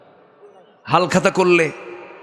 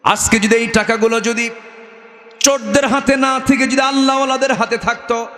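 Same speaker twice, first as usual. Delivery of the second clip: high sermon voice climbing to a cry; impassioned preaching; 12 semitones higher than usual. Acted. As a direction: build higher toward the climax.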